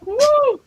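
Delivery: high, loud whoop for her frenzy; a sudden shrill-free high woo; mock frantic whoop. Mimicking how worked up the girl was with a high loud woo. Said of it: "But I mean, she was".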